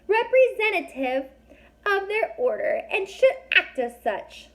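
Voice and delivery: very high, tiny, squeaky fairy voice; a little fairy snapping at strangers, high and squeaky. A narrator's reading. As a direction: speak like a fairy only inches tall. Fairy voice held.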